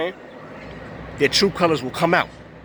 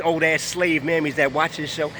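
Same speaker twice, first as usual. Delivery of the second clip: usual speaking voice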